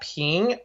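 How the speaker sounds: "ping" rising as if asking; question-like rise. Shown as a demonstration. Teacher explaining how to get "ping" right. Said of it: "it's like you're asking a question".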